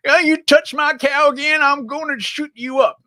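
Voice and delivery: high hammed-up American farmer threat; bad US accent. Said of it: "crap U S accent".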